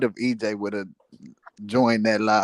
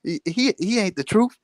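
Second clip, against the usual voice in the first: Crawford mocked in a much higher voice; 10 semitones higher than usual. Friend retells Crawford calling him a liar, high mocking. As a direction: pitch up high, teasing and giggly.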